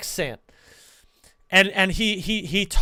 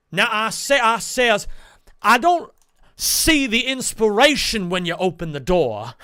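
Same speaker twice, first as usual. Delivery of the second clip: an old-timey Southern blowhard critiquing acting, louder; acting teacher as Foghorn Leghorn; 4 semitones higher, 5 dB louder than usual. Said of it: "my acting teacher goes".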